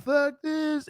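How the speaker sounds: squeaked high curse, pulled back in volume; panicked high yelp, half-swallowed; high strangled curse while bolting; retold scream. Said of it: "I know I screamed that out loud".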